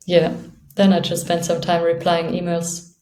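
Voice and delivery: usual speaking voice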